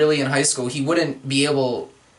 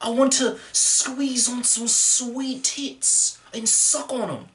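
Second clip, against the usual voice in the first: high, slow Australian hipster drawl; lazy Australian party boy, dragging the words; 7 semitones higher, slower than usual. Pretend. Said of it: "I can do an Australian hipster".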